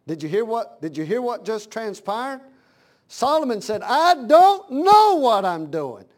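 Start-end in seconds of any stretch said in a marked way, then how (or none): 3.8-6.0 loud, high, drawn-out admission; preacher acting a desperate plea; desperate confession. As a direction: cry it out slowly, every word stretched. Acted Solomon's confession to God.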